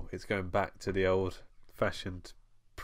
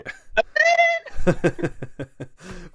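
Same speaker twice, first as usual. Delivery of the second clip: high loud laugh; 10 semitones higher, 8 dB louder than usual. Real, not acted. Laughing high at the Batman voice joke. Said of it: "You look like a right idiot".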